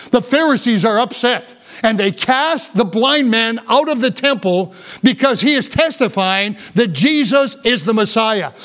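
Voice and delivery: ordinary talk, nothing marked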